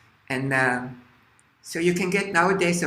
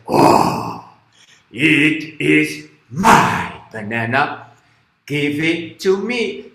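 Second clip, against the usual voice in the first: loud, very slow lion voice; roaring lion; 7 dB louder, much slower than usual. Acted. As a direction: open with a roar, then claim it slowly. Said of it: "the lion says".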